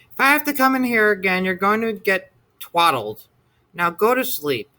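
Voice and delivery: fast, high, fed-up scolding; a monster mom laying down the law; stern warning. A narrator's reading. Monster mother threatens son.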